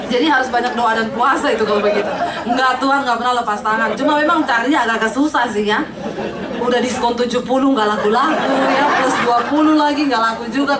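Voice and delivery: giggling through the joke; laughing. Real, not acted. Preacher joking about old maids, laughing. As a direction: laugh along with the crowd.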